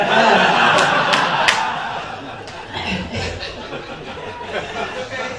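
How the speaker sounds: long unvoiced laugh, close to crying; breathless teary laugh. Real, not acted. Pastor overcome mid-sermon, breathless teary laughter. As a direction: let the laugh shake out silently. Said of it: "I'm gonna cry".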